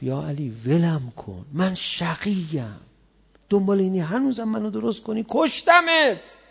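defiant assassin. Retold or imitated. The assassin answering Ali.

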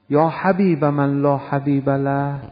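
slow, drawn-out Arabic supplication; measured invocation in prayer; prayer recitation. Acted. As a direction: recite slowly, stretching each phrase.

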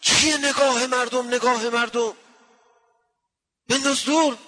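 high, loud scolding cry; retold shout. The master suddenly shouts at his student, high and loud. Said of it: "یه داد زد سر من".

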